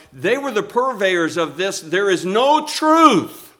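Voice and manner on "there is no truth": mocking quote. Preacher voicing protesters' slogan.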